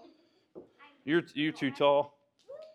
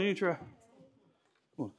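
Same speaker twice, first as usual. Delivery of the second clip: ordinary talk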